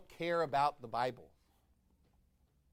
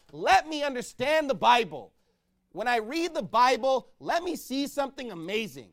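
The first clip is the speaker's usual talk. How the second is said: louder, raised preaching, scripture slowed; fervent preaching; 6 semitones higher, 7 dB louder than usual. Acted. Preacher urging the church to read, raised and fervent. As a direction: press the verse home to the church.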